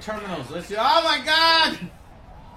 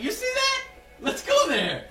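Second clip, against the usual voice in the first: quoting the game; 11 semitones higher than usual. Retold or imitated. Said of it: "game that's like".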